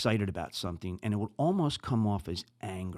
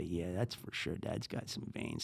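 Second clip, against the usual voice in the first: soft, hushed teasing aside; kids' quip; 5 semitones lower, 12 dB quieter than usual. Retold or imitated. Podcaster quoting his kids' quiet teasing. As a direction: mutter it like the kids would. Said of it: "My kids will probably say".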